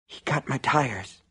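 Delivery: slow, hushed distress; whispered. A narrator's reading. Wronged man confiding in shopkeeper, whispered. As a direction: say it slowly under your breath. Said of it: "Hugh whispered".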